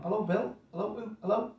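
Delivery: re-enacted tentative, rising hellos; puzzled phone greeting. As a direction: answer the phone unsure who is calling. Acts out answering a puzzling phone call.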